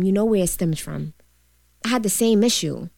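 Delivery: ordinary talk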